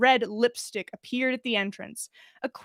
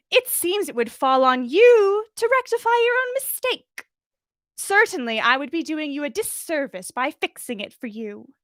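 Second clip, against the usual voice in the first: high, prissy, louder reprimand; prim scolding; 5 semitones higher, 3 dB louder than usual. Acted. Umbridge lecturing the rude server, prim and shrill. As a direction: scold like a sugary headmistress.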